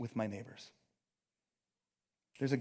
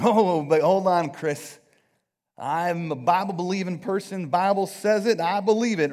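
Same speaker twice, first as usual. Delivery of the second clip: loud, higher Southern drawl of a Bible believer; Southern accent; 4 semitones higher, 10 dB louder than usual. Acted. Preacher voicing an objecting Bible believer, loud Southern accent. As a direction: put on a big Southern accent, loud and insistent. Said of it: "I said that with a Southern accent".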